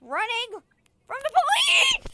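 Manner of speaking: very high loud cry; high loud playful alarm; playful shout. Pretend. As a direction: yell it while running. Fleeing police in game.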